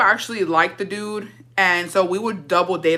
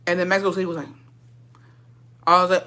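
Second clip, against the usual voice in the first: ordinary talk, nothing marked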